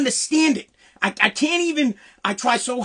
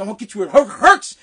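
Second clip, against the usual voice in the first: imitated stuttering threat; 6 dB louder, faster than usual. Caddy master threatening a young caddy, stuttering on "hurts". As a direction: catch on "hurts", then punch it. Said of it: "he stuttered".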